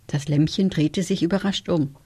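ordinary delivery

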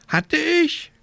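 high croaking question; croaking raven. A narrator's reading. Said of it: "krächzte Abraxas".

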